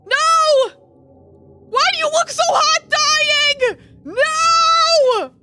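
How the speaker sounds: high, loud cry of grief; anguished scream. Acted. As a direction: scream as he lies dying. Finds dying huntsman, screams.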